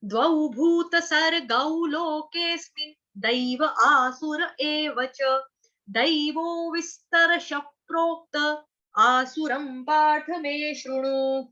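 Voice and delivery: slow chanted Sanskrit verse; slow shloka recitation. Shown as a demonstration. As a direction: recite the verse slowly for children. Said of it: "आपको already समझा दिया है". (धीमा श्लोक पाठ)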